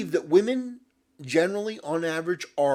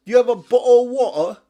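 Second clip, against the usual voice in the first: loud, hammed-up British commoner voice; obnoxious British Link; 6 semitones higher, 13 dB louder, faster than usual. Mocking a hero as a loud British commoner talking to royalty. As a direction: ham up a rowdy British bloke accent. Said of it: "obnoxiously British".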